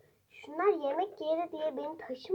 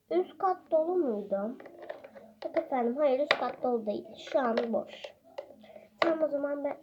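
normal talking voice

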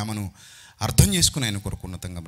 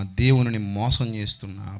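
usual speaking voice

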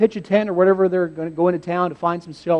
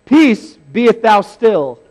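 loud, slow, grand King James delivery; hammed-up pompous command; mock-solemn; 3 semitones higher, 8 dB louder, slower than usual. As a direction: intone it like a stately proclamation. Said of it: "Does he say".

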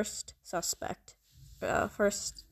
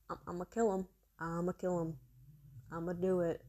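muttered threat; 3 semitones lower, 8 dB quieter, faster than usual. Real, not acted.

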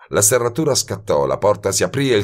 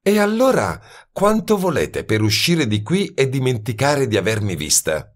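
higher woman's voice, probing; bargaining young woman; 6 semitones higher than usual. A narrator's reading. Woman sounds out detective's price, lightly voiced. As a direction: test whether he can be bought.

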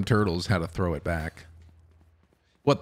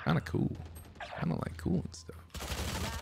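whispering; 8 semitones higher, 10 dB quieter than usual. Acted. Mumbled aside.

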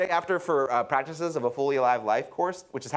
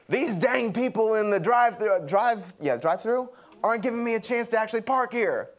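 huffy woman; 7 semitones higher than usual. Retold or imitated. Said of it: "she's like".

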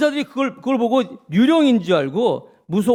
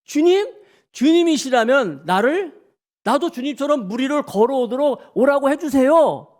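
high, startled recognition turning into a drawn-out plea; Peter's sudden surprise; 7 semitones higher than usual. Acted. Peter suddenly recognizing the Lord on the water, acted excited surprise. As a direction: blurt it high, then beg eagerly. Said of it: "베드로가 느닷없이".

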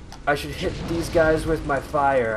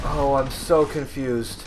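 ordinary delivery

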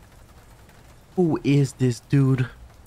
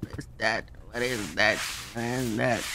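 hushed dread; 7 dB quieter than usual. Real, not acted. Player spotting something in the dark, hushed dread. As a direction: ask under your breath.

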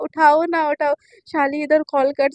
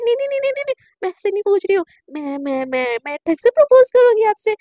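fast, high rush of no-no-no; flustered backpedal; 4 semitones higher, 5 dB louder, faster than usual. Retold or imitated. Girl scrambling to reassure her crush, fast and high. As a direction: hurry to correct yourself.

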